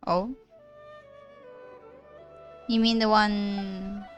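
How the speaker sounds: ordinary talk, nothing marked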